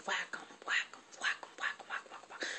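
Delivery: soft unvoiced whack whack of the switch; whispered whipping sound. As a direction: whisper the rhythm of the blows. Imitating the whipping strokes.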